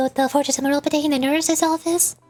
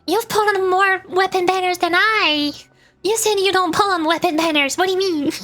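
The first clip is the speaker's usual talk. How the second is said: playful indignation; 5 semitones higher than usual. Real, not acted. Streamer calling out a viewer on weapon banners, playfully indignant. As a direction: call them out, raised pitch, mock outraged. Playfully accusing chatter of contradicting themselves.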